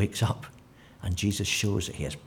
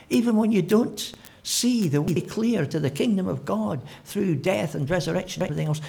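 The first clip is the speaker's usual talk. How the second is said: usual speaking voice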